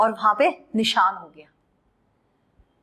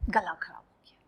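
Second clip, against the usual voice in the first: whispered as if hoarse; whispered sick-throat act; sore throat whisper; 6 semitones lower, 9 dB quieter, faster than usual. Pretend. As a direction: mime a hurting throat. Acts sore throat again.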